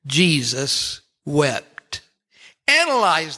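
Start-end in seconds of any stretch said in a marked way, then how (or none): 0.1-2.0 Jesus wept, drawn out slowly with a heavy fall; slow weighty reading. Shown as a demonstration. Preacher to congregation on shortest verse, slow solemn reading. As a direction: let each word of Jesus wept hang, very slow. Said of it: "shortest verse in the Bible".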